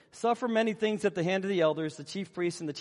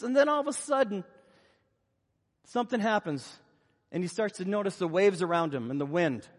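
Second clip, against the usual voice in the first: ordinary talk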